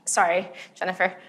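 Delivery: normal talking voice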